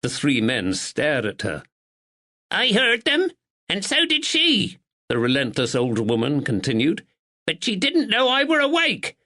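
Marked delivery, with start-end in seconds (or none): 2.5-4.8 put-on sharp old woman. A narrator's reading. 7.4-9.2 put-on sharp old woman. A narrator's reading.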